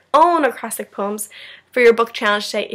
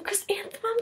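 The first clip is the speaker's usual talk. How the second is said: dreamy whispered name; 12 semitones higher, 12 dB quieter than usual. Acted. Chrysanthemum whispers her own name to herself in wonder.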